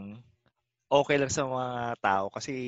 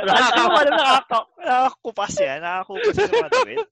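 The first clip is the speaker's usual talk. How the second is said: laughing; 13 semitones higher, 9 dB louder than usual. Real, not acted. Laughing while mocking.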